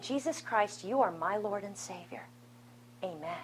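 softly voiced prayer of faith; quiet reverent response; hushed prayer. Prayerful quote of communion response.

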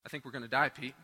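low, soft, deadpan dread; deadly earnest, kept low; dead serious. Retold or imitated. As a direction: imitate a grave, quiet confession. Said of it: "he looks at me dead seriously".

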